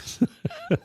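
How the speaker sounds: very high squealing laugh. Real, not acted. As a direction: burst out in a squeal. Laughs after the cartoon bit.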